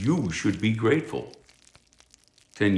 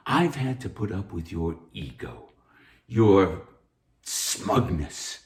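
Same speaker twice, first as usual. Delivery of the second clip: normal talking voice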